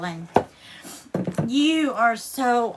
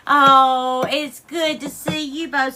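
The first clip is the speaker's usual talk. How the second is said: normal talking voice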